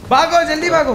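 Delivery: high, urgent call to flee; urgent command. Real, not acted. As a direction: rush your teammates away. Orders team to run from enemies. (ऊँची आवाज़ में हड़बड़ी भरा आदेश)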